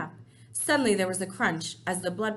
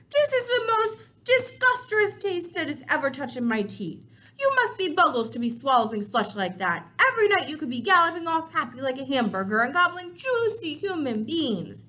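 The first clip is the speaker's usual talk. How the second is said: disgusted giant rant; 7 semitones higher, 7 dB louder than usual. Acted.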